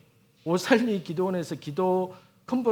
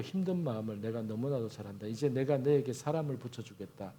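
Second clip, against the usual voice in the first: low, soft inner voice of God, lower and quieter; God speaking softly; 5 semitones lower, 5 dB quieter than usual. Retold or imitated. A preacher voicing God softly to his tired heart, lower and quiet. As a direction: let the quiet voice in the heart comfort him. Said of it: "하나님께서 제 마음에".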